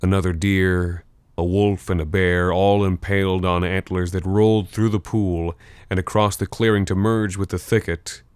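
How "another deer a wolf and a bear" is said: plain reading voice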